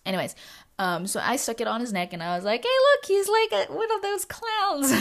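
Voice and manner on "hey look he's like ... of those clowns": much higher and louder, delighted; gleeful exclamation. Retold or imitated. Showing off the dog in a ribbon collar, gleeful and high.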